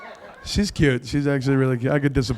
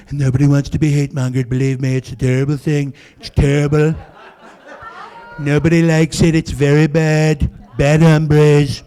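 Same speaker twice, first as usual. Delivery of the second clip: Trump voice; 3 dB louder than usual. Retold or imitated. Comic does Trump for a Nevada crowd, lightly voiced. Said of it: "I can't do that voice".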